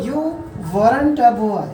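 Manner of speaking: British-style "weren't a" as wur-run-ta. Shown as a demonstration. Teacher models for students, British-style contraction. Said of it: "British style में कैसे बोलेंगे".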